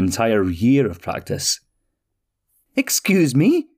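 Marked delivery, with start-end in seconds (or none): 2.7-3.7 high, startled little man; startled exclamation. Acted. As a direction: jump up in surprise at the name. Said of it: "exclaimed Petrie".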